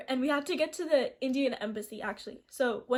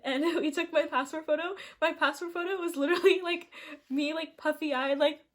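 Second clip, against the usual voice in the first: ordinary talk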